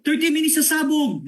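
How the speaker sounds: high, urgent warning cry; mock alarmed shout. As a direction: yell the bomb warning like a panicked bystander. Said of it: "may sumigaw".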